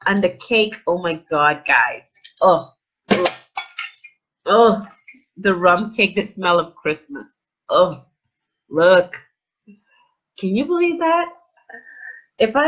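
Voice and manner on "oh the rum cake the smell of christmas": ordinary talk, nothing marked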